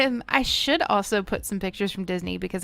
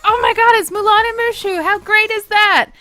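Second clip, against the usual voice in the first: much higher and louder squeal; delighted onlookers; 13 semitones higher, 11 dB louder than usual. Retold or imitated. People in line spotting Mulan and Mushu, high delighted squeal. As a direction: gush with delight. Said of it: "people behind me were like".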